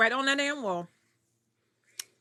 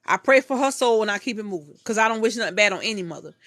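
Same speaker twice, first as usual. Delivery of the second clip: usual speaking voice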